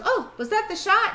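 put-on high, surprised little boy. Boy asking the nurse about his shot, high and surprised. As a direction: play a small child who didn't notice. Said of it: "and he goes".

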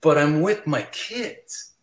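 slow, drawn-out protest; pointed, slow pleading objection; insistent refusal. Retold or imitated. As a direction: stretch it out to insist. Insists after fan pushes back.

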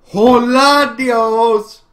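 excited shout. Real, not acted. Cheering in a game.

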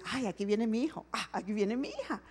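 gently voiced God, delighted and soft; tender proud father. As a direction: coo over a child coming home. God voiced proudly welcoming child.

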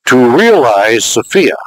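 slow, deliberate reading of To realize Sophia; letting To realize Sophia sink in, slowly. Shown as a demonstration. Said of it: "I'll read it slowly".

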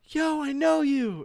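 high, loud mock freak-out; imitating fans who freak out, hammed-up excitement; excited fan. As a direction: squeal it like a starstruck fan. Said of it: "they're like".

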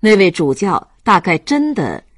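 plain reading voice

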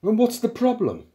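high, loud question; exasperated challenge. Acted. Programmer challenging the protesting philosophers, exasperated.

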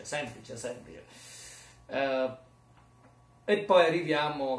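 ordinary talk, nothing marked

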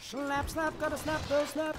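high, loud playful whooping; silly battle cry. Pretend. Player slapping foes in game, silly high shouting. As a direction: squeal nonsense war cries.

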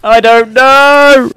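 loud exasperated retort. Real, not acted. Laughing retort after a joke.

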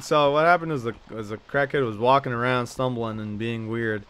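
ordinary delivery

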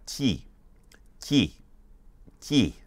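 T said as "tyee", like T-Y-E-E; separate, crisp takes of T as "tyee"; Mandarin seven. Shown as a demonstration. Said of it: "It's like T Y E E".

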